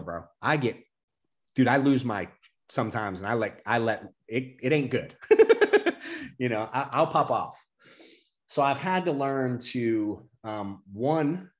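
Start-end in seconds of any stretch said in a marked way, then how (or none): 5.2-6.3 sudden squeaky laugh, far up; real laugh that jumps high; high burst of laughter. Real, not acted. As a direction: let a shrill laugh escape. Laughing at own temper.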